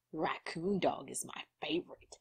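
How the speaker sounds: hushed, unvoiced whisper. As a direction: breathe it out as a whisper. Voices a character's line.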